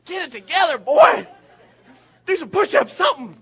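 loud joking bark; hammed-up tough-dad shout; mock gruff dad. Father teasing his crying son, loud mock scold. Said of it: "I'm like".

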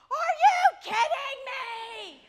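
disbelieving shout through laughter, high and loud; slow, loud and laughing; laughing yell. Retold or imitated. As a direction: yell it while cracking up. Mom yelling in disbelief.

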